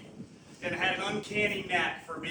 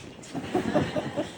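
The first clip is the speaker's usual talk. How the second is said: real laugh breaking through tears; tearful laugh; 8 semitones higher than usual. Real, not acted. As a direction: burst of breathy laughter. Laughing at the sandwich story.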